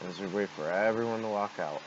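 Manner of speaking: ordinary delivery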